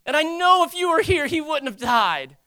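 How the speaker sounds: high, loud plea to the Lord; trusting lament. Acted. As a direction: cry out in faith, drawing out the line ends. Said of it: "it's not a condescending way".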